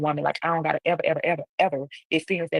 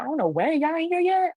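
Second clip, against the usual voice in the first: disbelieving question; 12 semitones higher than usual. Retold or imitated. Disbelief that mom hasn't arrived yet.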